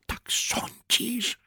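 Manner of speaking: slow anxious whispered question; worried whisper. A narrator's reading. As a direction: whisper it, uneasy. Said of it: "szepnął zaniepokojony Berlioz".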